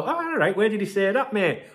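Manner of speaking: breezy doubting mate; casual sceptical reply. Pretend. Advising viewers how to answer a rumour, breezy and sceptical. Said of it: "Just go".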